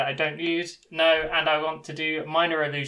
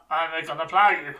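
almost threatening. Acted. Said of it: "Sounded almost threatening".

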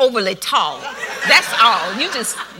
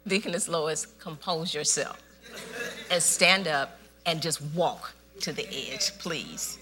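calm coaxing; 4 semitones lower, 8 dB quieter than usual. Retold or imitated. Calm instruction to panicked woman.

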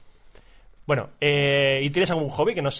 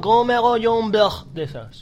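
slow, higher-pitched dopey youngster; typical clueless student; 7 semitones higher, slower than usual. Acted. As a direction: drag it out like a clueless kid in class. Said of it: "no van a ir los típicos".

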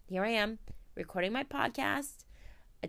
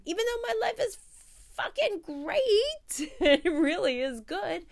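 laughing emphatic; 10 semitones higher, 7 dB louder than usual. Real, not acted. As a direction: laugh through the words.

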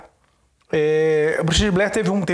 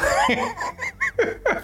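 high burst of laughter; 3 dB quieter than usual. Real, not acted. Laughing at the story.

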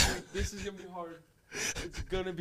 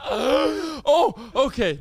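laughing crying; 8 semitones higher, 5 dB louder, much slower than usual. Real, not acted. Cry-laugh burst.

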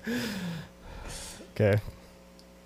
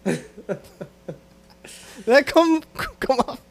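ordinary talk

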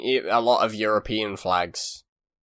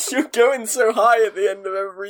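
laughing; 11 semitones higher, 4 dB louder than usual. Real, not acted. Laughing while mocking the reading.

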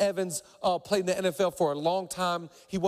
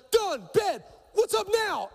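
intense bark; 13 semitones higher, slower than usual. Retold or imitated. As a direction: fire the questions like a linebacker ready to go. Heath returning eager.

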